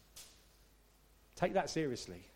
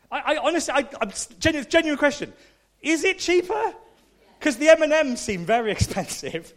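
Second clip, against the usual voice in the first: much higher and louder, eager asking; excited high question; 13 semitones higher, 10 dB louder than usual. Real, not acted. Preacher asking the congregation about duty free, excited. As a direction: ask it like you really need to know.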